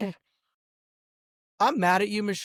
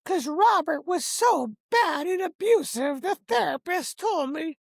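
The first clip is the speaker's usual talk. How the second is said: much higher whiny mock voice; mocking Michelle; 11 semitones higher than usual. Predicting his ex-wife's excuse, in a much higher mocking voice. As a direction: parody her excuse in a whiny voice. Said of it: "you're gonna say".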